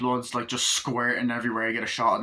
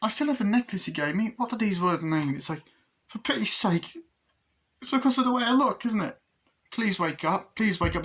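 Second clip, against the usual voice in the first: put-on high, pleading young voice; Glenn's voice; 9 semitones higher than usual. Glenn begging the dolls to wake, high and pleading. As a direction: beg them to wake, high. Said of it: "he's like".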